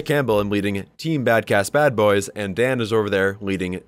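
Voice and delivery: ordinary delivery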